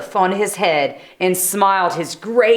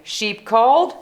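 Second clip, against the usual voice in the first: usual speaking voice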